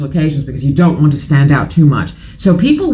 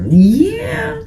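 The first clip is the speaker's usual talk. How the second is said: sarcastic high yeah. Real, not acted. Dismissive aside about Russell Brand.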